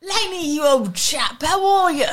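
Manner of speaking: high, loud hammed-up British chap; put-on British accent. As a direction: greet a pal in mock British. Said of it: "start talking in that accent".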